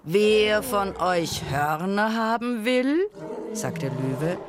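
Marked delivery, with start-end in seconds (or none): 0.0-3.0 high, slow, drawn-out proclamation; grand royal offer to a crowd; announcing lion king. A narrator's reading. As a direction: call out slowly, rising at the end.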